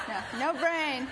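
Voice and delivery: laughs through the punchline, slowly; laughing at her own no-brain joke. Real, not acted.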